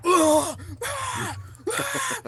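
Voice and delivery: very high mock scream of exertion; strained high-pitched yells, put on; imitated screaming. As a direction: scream out each rep, imitating. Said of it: "he's, like, screaming".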